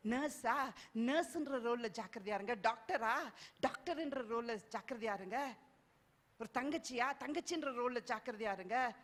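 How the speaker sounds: ordinary talk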